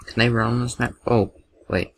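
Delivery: ordinary talk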